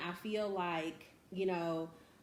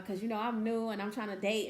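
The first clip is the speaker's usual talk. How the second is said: ordinary delivery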